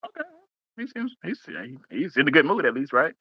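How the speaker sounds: puzzled customer. Retold or imitated.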